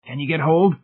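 a touch of strain while reaching; mildly grunted. A narrator's reading. Rescuer squatting on the stairs reaching down, lightly grunted. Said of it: "he grunted".

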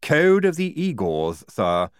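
slow, lisping Igor, reproachful; reproachful Igorina. A narrator's reading. Igorina correcting the major, slow and reproachful. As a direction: gently scold the major in a lisp. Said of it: "said Igorina reproachfully".

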